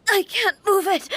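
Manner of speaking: high, strained, struggling voice; pained strain. Acted. Shogun unable to move, straining in pain. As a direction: fight to get the words out.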